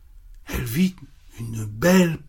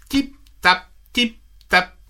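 high sing-song tip-tap of hooves; tip-tap of the bridge; 11 semitones higher, slower than usual. A narrator's reading. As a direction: tap it out lightly like little hooves. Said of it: "fit le pont".

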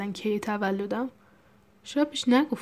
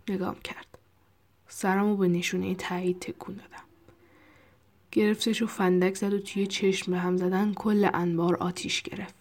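plain reading voice

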